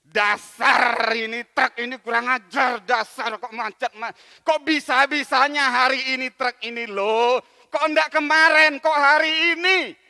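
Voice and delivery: loud furious ranting at the truck; loud grumbling rage at missing the plane; angry rant. Acted. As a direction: shout out your frustration. Angry man blaming the truck.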